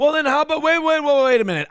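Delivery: high, loud wait wait wait; urgent interruption. Real, not acted. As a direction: jump in and stop them. Reactor cuts in to interrupt.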